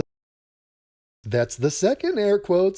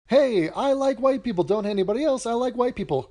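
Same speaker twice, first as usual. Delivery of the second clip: mock German voice; 6 semitones higher than usual. Mocking the video's German speaker, pitched-up mock quote. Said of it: "he's speaking German, literal German".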